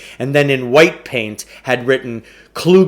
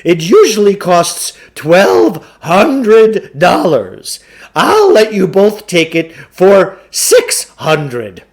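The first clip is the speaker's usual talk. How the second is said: higher, louder hammy huckster voice; a slick con man pitching the deal; booming carnival barker sell; pushy salesman; 8 semitones higher, 7 dB louder, slower than usual. A narrator's reading. Klugarsh pitches his course.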